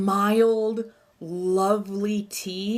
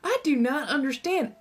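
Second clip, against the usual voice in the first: higher-pitched Southern twang; baffled, with Southern accent creeping in; 7 semitones higher than usual. Real, not acted. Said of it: "I get a more southern accent".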